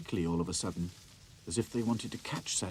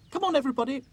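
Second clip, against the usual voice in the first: urgent doll voice; 8 dB louder, much faster than usual. A narrator's reading. Dotty urging the dolls to hide, higher. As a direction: hurry the others away.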